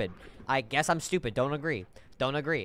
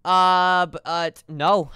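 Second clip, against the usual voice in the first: ordinary talk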